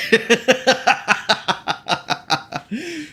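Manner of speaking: long, high run of laughter; teasing laugh. Real, not acted. Streamer asking viewers what they will understand, long amused laugh. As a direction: laugh at the challenge you set.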